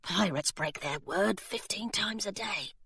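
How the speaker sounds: soft hushed aside; whispered aside. Acted. Charlie whispering to Hilary, soft and hushed. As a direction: lean in and whisper it. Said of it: "Charlie whispered to Hilary".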